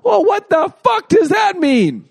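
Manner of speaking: hammed-up indignant yell at the reply; outraged shout. Boyfriend answering a texted I love you with Thanks, mock furious shout. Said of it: "I'd be like".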